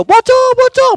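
very high, loud mock panic shriek; retold yelling. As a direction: shriek it like a fleeing prankster. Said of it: "bengak-bengok". (niru njerit wedi pocong)

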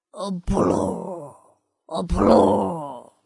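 a scared wolf faking a pig; trembling wolf oinking. Acted. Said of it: "老狼一边哆嗦".

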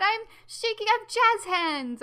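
high, loud, giddy; so excited. Real, not acted. Host telling listeners she is shaking, gleeful and loud. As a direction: squeal it with jazz hands. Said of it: "I'm so excited".

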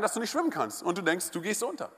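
ordinary talk, nothing marked

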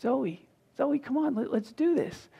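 calm her down tenderly; soft coaxing. Retold or imitated. Father softly coaxing his tantruming daughter, tender. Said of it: "I'm not screaming at her".

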